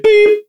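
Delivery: high, loud censor beep; imitated bleep. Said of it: "And then I said".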